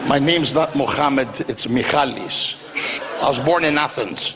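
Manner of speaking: slow, low mock-secretive vendor voice; a vendor swearing he is Greek, low and slow; fake Greek vendor. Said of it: "they always do this".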